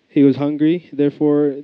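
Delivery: ordinary talk, nothing marked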